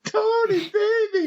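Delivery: falsetto wail, calling out; high character wail. Acted. Susie Soccer wailing to Tony in falsetto. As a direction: cry his name in a squeaky high voice.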